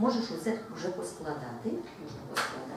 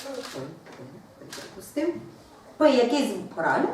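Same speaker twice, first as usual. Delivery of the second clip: ordinary talk, nothing marked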